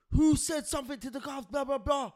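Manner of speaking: loud indignant question; retold shout. Retold guard shouting on wing.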